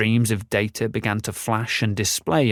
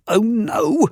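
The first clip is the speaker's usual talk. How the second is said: alarmed high cry; exclaiming; 11 semitones higher, 4 dB louder than usual. A narrator's reading. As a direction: yelp it in sudden alarm. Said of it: "exclaimed Macresh".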